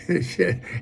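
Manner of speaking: short chuckle at own joke; soft little laugh between lines. Real, not acted. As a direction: chuckle softly at your own gag.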